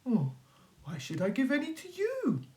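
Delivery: high, quick, affronted wolf; indignant wolf. Acted. The wolf refuses his greedy neighbours, high and quick. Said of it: "said Mister Wolf".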